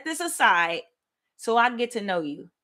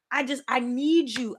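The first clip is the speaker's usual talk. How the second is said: ordinary delivery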